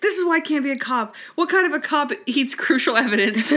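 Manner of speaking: high and laughing through the quote; mock despair cracked by laughter. Quoting Randy, laughing.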